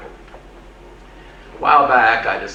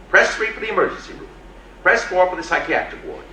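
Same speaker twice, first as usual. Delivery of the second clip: higher, brisk recorded menu voice; automated menu voice; 4 semitones higher than usual. Retold or imitated. Hospital menu listing options to the caller, higher brisk robot voice. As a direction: rattle off options like a phone robot.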